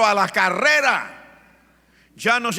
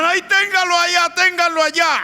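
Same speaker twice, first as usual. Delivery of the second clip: much higher, shouted alarm; panicked shout; 13 semitones higher, 6 dB louder than usual. Retold or imitated. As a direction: yell in terror to hold him back. The devil fears Jesus coming.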